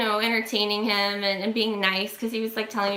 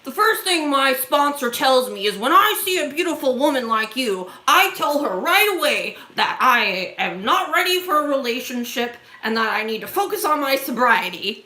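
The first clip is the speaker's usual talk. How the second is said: lightly voiced, louder earnest old man; earnest stranger; 3 semitones higher, 3 dB louder than usual. Stranger explaining his sponsor's advice, a hint of earnest preaching. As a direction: deliver the stranger's sincere advice a bit overdone. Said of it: "then he's like".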